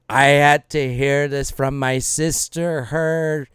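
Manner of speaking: slow, drawn-out scolding; disappointed mom. Acted. Mom confronting her son over what he did, slow and disappointed. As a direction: stretch each word like a hurt mother. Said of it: "And she's like".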